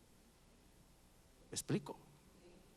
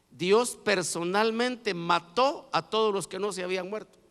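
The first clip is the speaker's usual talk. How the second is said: ordinary talk, nothing marked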